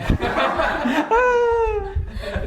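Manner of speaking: high, bright peal of laughter; burst of laughter. Real, not acted. Speaker laughing at himself while telling the wedding story, a real high laugh. Laughing at his own tears.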